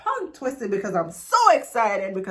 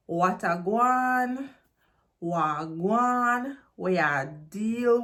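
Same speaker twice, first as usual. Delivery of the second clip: slow Jamaican patois phrase; 2 semitones higher, much slower than usual. Shown as a demonstration. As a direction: lean into the patois, slowly. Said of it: "The patois version".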